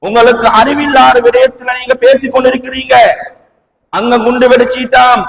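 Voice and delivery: usual speaking voice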